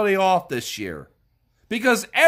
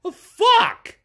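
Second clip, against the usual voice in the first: incredulous outburst; 11 semitones higher, 3 dB louder than usual. Real, not acted. Exasperated outburst in a rant.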